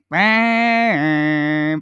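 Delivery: loud, drawn-out mock wail; long wailing yell. Fan hears crush is engaged, mock anguished wail. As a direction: let out an inner scream, held long. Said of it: "the inside of me is like".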